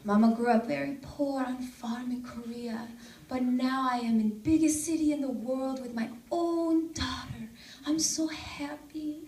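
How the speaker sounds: soft Korean-accented impression of mom; Korean mother's accent. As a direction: imitate mom's Korean English, hushed and heartfelt. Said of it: "I do do my mom's accent".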